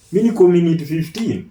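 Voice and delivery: ordinary delivery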